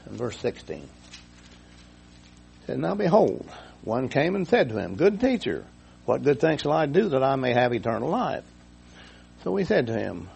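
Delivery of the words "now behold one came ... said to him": raised, ringing reading voice; scripture reading. Acted. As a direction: read the verse out like a preacher. Scripture read aloud, raised.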